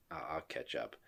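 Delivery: very low, soft retold excuse; quietly backing out, in mimicry; imitating unenthused wife. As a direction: drop right down, hesitant and subdued. Retold wife losing interest.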